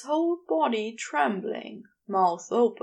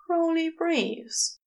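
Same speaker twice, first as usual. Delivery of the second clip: ordinary delivery, nothing marked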